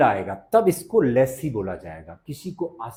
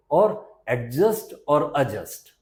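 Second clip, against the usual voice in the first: normal talking voice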